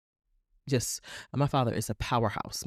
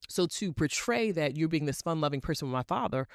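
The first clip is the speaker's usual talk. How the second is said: ordinary talk, nothing marked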